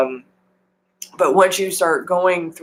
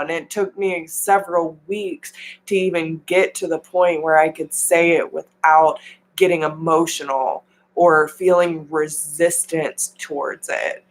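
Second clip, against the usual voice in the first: ordinary delivery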